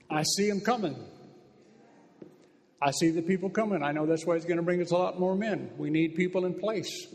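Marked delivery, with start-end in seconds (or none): none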